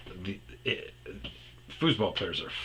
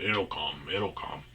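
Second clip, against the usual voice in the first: imitating Guido; 4 semitones lower, 4 dB quieter than usual. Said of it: "Guido would always say that".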